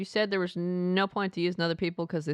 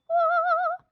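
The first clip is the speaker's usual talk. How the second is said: loud, very high wail; transformation noise; 6 dB louder than usual. Acted. Imitating the transforming alien, high loud wail. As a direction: imitate a creature changing with a high cry. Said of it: "It's like".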